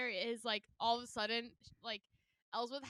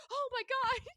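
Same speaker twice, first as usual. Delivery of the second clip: put-on much higher startled little boy; imitating shocked kid; 14 semitones higher, 5 dB louder than usual. Retelling the boy realizing his mistake, high startled gasp. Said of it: "he goes".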